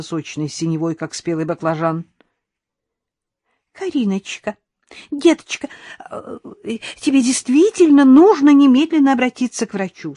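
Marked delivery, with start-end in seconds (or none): 3.7-10.1 high, chirpy, fussing sweetness; twittering. A narrator's reading. Mother-in-law urging Karina to see a doctor, twittering. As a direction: chirp it loudly, pitched way up. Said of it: "снова защебетала Сандра".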